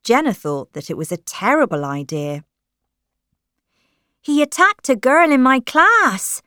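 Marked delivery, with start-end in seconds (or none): 4.2-6.4 put-on higher, louder alarmed girl's voice. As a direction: blurt out the warning. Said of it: "she said".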